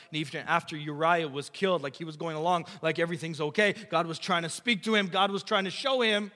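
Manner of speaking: building back up to a preacher's call; rising preaching. Acted. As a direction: climb from talk into preaching.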